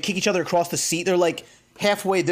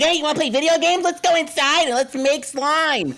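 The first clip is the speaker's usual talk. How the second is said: put-on high, sweet kid voice; sing-song kids pretending nothing happened; kids' voice; 7 semitones higher, 3 dB louder than usual. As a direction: mimic the kids asking nicely.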